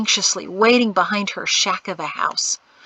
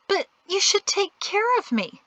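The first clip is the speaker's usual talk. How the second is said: whiny plea; 12 semitones higher than usual. Acted. Pleading girl.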